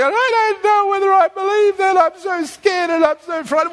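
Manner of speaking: high, wailing mock worry; hammed-up frightened whinge, pitched high; mock whining complaint. Preacher imitating a believer doubting God's word, mock fearful whining. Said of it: "complaining and grumbling all day".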